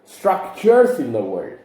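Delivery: slow, "structures" pressed with a clear final s; stress on structures. Shown as a demonstration. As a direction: hit the plural "structures". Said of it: "plural, plural".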